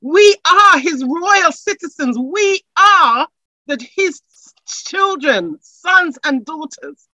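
high, loud, rousing preaching that keeps climbing; full-force preaching. Acted. Pastor lifting the congregation, full-force rousing preaching.

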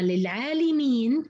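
للعالمين as lil-'aalimeen, i after lam; kasra on the lam. Shown as a demonstration. Teacher contrasting the scholars' meaning, lam with i. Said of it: "بمعنى الجمع العلماء".

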